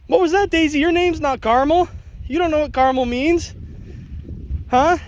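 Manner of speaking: loud, high-pitched teasing of a dog; mock questioning. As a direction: play baffled at the dog, over the top.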